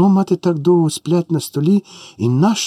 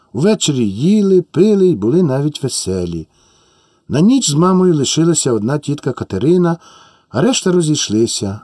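ordinary delivery, nothing marked

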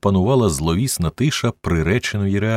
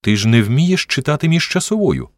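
sudden realization, higher exclamation; higher-pitched narrated outburst; exclaiming; 7 semitones higher, 3 dB louder than usual. A narrator's reading. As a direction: cry it out as it dawns on you. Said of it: "вигукнув він".

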